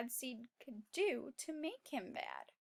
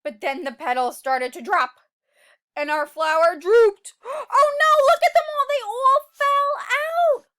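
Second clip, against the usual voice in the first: much higher and louder, gasping; dramatic alarm; 8 semitones higher, 13 dB louder than usual. Acted. Teacher dramatizing the drooping flower, high and loud alarm. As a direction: sound the alarm as the flower drops. Dramatic sad turn, cry.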